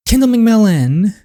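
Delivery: dad catching his daughter out late, loud and stern; angry shout. Acted. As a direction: bark her full name in anger.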